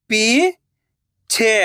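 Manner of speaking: पीछे drawn out slowly, syllable by syllable; slow syllable split. Shown as a demonstration. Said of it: "मैं किस तरीके से".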